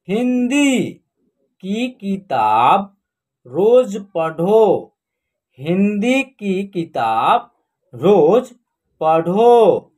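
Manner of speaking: slow word-by-word sentence reading; teacher reading slowly word by word; slow reading aloud. Shown as a demonstration. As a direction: read it out slowly for learners. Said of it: "हम direct पढ़ना सिखाएंगे आपको".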